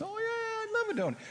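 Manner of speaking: very high, bright-eyed eager student voice; eager girl. Retold or imitated. A student gladly accepting the professor's donut, very high and eager. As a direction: squeak it out like a delighted young woman.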